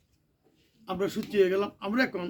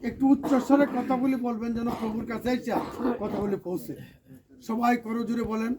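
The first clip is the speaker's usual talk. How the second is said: ordinary talk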